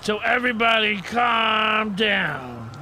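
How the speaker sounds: preachy slow shout. Acted. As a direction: proclaim it to the crowd. Addresses the train passengers.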